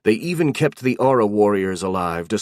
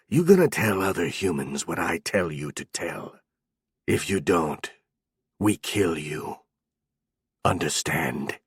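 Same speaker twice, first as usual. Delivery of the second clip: lower, softer, broken-Imperial menace; goblin shaman threat; 3 semitones lower, 3 dB quieter than usual. A narrator's reading. Goblin shaman orders Zeno to carry his message, quietly menacing.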